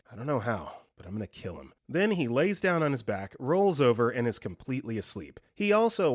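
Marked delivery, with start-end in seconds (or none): 0.1-1.7 soft, low sleepy menace; low creepy threat. Retold or imitated. Sleeping roommate threatens someone, low soft menacing murmur.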